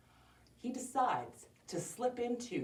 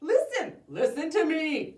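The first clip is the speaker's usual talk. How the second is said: raised, insistent plea; pleading wolf; 9 semitones higher, 7 dB louder than usual. Acted. Wolf tries to get their attention, insistent plea. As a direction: beg to be heard over the noise.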